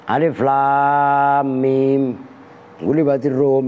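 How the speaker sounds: slow, drawn-out chanted recitation; Quran recitation. Acted. Preacher reciting Surah Ar-Rum. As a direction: recite it slowly like tilawah.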